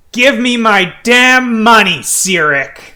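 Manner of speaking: loud, high demand, dropping on the name; full-voiced furious demand; angry shout. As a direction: yell it like you're owed money. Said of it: "and shouts".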